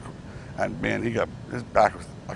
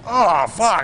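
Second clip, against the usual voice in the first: surprised; 9 semitones higher, 8 dB louder than usual. Retold or imitated. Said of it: "He goes".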